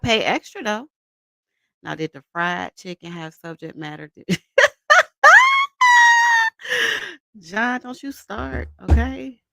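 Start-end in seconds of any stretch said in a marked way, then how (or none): 4.3-7.1 high, loud squealing cackle; shrieking laugh. Real, not acted. Cracking up at John's chicken joke, shrieking laugh. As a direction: burst out in a screaming laugh.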